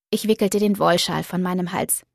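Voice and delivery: usual speaking voice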